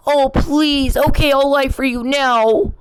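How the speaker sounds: high mocking whimper, a pretend tearful give-in; mock crying plea. Mocking the man, pretending to cry and cave in to his pressure. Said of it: "Do you think I'm gonna cry?".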